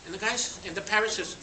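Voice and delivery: ordinary talk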